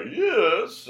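high, loud, stretched-out comic yes; Gilbert's catchphrase. Retold or imitated. Old comedy character turns around to upset Benny, hammed-up high cry. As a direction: pitch it way up like an old-show catchphrase. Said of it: "he would turn around and go".

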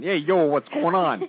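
drawn-out Brooklyn street greeting; slowed, put-on Brooklyn accent. Shown as a demonstration. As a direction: lay on a lazy Brooklyn accent. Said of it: "You hear my Brooklyn accent".

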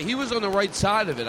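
ordinary talk, nothing marked

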